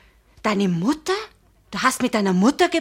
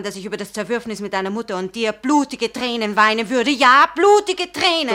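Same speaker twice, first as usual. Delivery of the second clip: anguished outburst; 5 dB louder than usual. Acted. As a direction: build into a desperate cry. Passionate outburst in quarrel.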